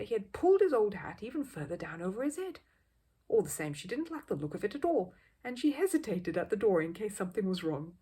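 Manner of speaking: plain reading voice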